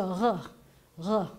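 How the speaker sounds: "ruh" with a throaty French-style R; guttural resh. Shown as a demonstration. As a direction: rasp the "ruh" from the back of the throat. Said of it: "So this is a".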